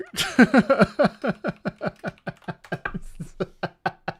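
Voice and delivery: long, high, real laughter; lingering laughing fit. Real, not acted. Streamer still tickled by the slip, long laughing fit. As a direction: keep cracking up until winded.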